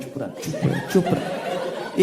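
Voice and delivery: urgent whispered hush; whispered shush. Retold or imitated. Shushing family at station. (दबी आवाज़ में फुसफुसाकर चुप कराना)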